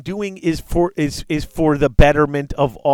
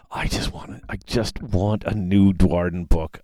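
whiny longing; 7 semitones lower than usual. Real, not acted. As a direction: moan it with longing.

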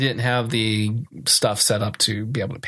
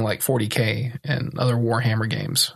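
ordinary talk, nothing marked

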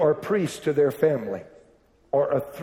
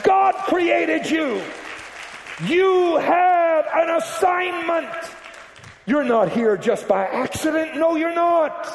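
fiery preaching; 12 semitones higher than usual. Acted. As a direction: preach it out to the house. Preaching at full force.

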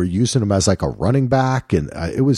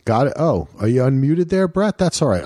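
ordinary delivery

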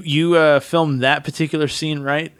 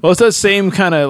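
normal talking voice

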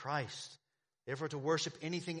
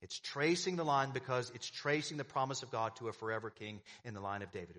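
ordinary talk, nothing marked

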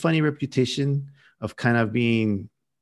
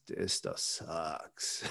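disgusted mutter; 6 semitones lower, 13 dB quieter, much slower than usual. Retold or imitated. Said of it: "And I was like".

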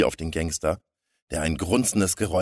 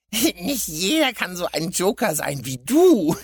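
high, loud snickering sidekick; giggling mumble; 12 semitones higher, 7 dB louder than usual. A narrator's reading. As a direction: giggle before and after, like a little crook. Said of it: "Der Kleine nuschelte kichernd". (nuschelnd kichernder Schurke)

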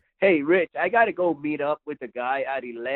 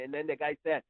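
ordinary talk

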